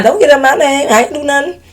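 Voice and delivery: high-pitched defensive protest; squeaky-high mock defensiveness; playful protest. Real, not acted. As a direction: jump up high to deny all blame. Playfully disowns the troublemaker's name.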